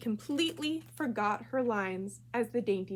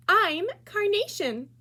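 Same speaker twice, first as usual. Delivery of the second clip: put-on much higher, louder and slow, proud girl; eager schoolgirl; 10 semitones higher, 8 dB louder than usual. Rita picking a flower name to copy her, high proud voice. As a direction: announce it proudly in a high child voice.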